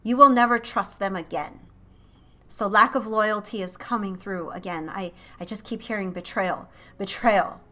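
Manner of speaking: ordinary talk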